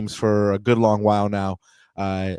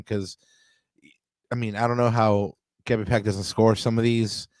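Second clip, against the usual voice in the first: ordinary talk, nothing marked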